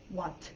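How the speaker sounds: "wat" ending on a released T; UK-style "wat" with a true T; sounded T. Shown as a demonstration. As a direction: break off "wat" right on an audible T. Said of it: "they have the T".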